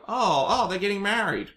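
put-on childlike excitement. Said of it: "piece together as a child".

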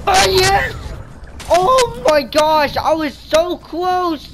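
very high, loud, slow shriek of relief; ecstatic squeal. Real, not acted. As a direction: squeal it out, barely survived.

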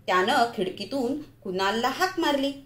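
ordinary delivery

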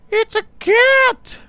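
high, slow squeal of shock; startled shriek. Real, not acted. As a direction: jump out of your skin at a cat. Sudden startled find.

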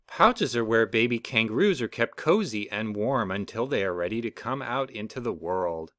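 ordinary delivery, nothing marked

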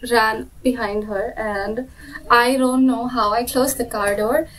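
ordinary delivery

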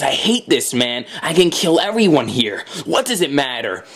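usual speaking voice

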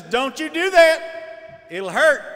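loud, slow stern-mother warning; mother's warning. Retold or imitated. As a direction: warn the kid off the outlet, loud.

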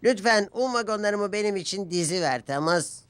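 character voice. A narrator's reading. Character voice in read story.

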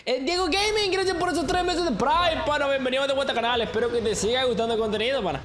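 high, excited streamer shout-out; agradecimiento eufórico. Real, not acted. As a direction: thank a new subscriber with high, rushing energy. Agradece una suscripción en directo. (agradecimiento agudo y entusiasta)

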